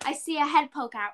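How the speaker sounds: usual speaking voice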